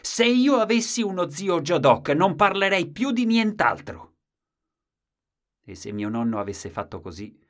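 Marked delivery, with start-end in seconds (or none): 0.0-4.1 put-on loud, high child's outburst; furious shout. A narrator's reading. Boy defending grandfather against grandmother, furious shout. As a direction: yell it in defiance. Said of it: "e gridai".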